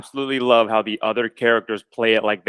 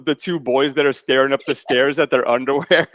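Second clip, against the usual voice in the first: ordinary talk, nothing marked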